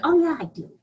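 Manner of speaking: a light mock of the smug mind. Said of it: "And the mind is like".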